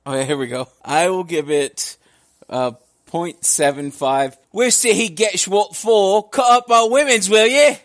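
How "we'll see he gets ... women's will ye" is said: put-on horrible Cockney accent. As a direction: belt it out as a bad Cockney avenger. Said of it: "say it with a horrible Cockney accent".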